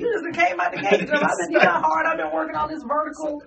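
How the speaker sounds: high, loud mock protest with laughter; indignant disbelief. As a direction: protest like an outdone veteran athlete, hammed-up. Acted protest at a newcomer jumping.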